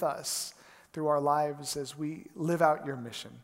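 soft, earnest congregational prayer. Real, not acted. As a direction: pray quietly over the church, unhurried. Pastor opens with prayer.

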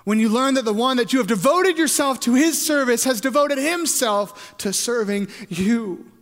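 high, loud rush slowing at the end; swelling pulpit delivery; impassioned preaching. Real, not acted.

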